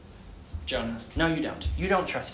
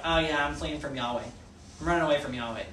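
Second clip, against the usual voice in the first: offhand and easy, a bit quieter; casual shrug; 4 semitones lower, 8 dB quieter, faster than usual. Retold or imitated. Preacher imitating Jonah telling the sailors, casually offhand. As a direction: toss it off like it's nothing. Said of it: "he just kinda casually was like".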